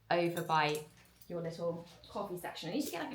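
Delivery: ordinary talk, nothing marked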